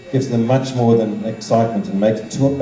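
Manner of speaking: usual speaking voice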